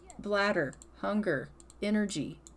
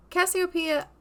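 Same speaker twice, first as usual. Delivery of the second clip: calling out sharply; 8 semitones higher, 4 dB louder than usual. Real, not acted. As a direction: call out Cassiopeia, higher and louder. Scolding a Sim by name.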